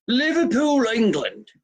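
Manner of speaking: high-pitched mock Liverpool accent; bad English accent. Host mimics fan's Liverpool home town, terrible English accent. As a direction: put on a terrible Liverpool accent, leaping up high then dropping. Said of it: "That's the worst accent you've ever heard".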